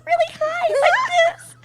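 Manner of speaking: very high squeaky voice; helium voice. Shown as a demonstration. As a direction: talk as if you'd inhaled helium. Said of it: "Goes up, like, really high".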